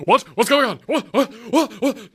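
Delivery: quick, high, yappy questions; an eager dog barking out questions; overexcited dog. Acted. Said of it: "It sounds like an overexcited dog".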